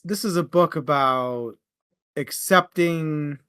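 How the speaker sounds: ordinary delivery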